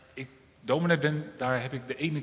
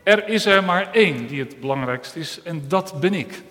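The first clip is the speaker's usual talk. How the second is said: boastful claim; 2 semitones higher, 8 dB louder than usual. Acted. Proud eye claims importance.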